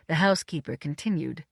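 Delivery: ordinary delivery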